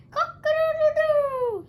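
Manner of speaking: very high, loud rooster screech; screeching crow. A narrator's reading. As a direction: screech the wake-up call. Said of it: "screeched Cockerel".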